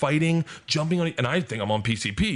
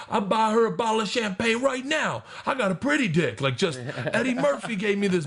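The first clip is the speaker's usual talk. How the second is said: loud boisterous Tracy; 10 semitones higher than usual. Retold or imitated. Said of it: "loud and boisterous".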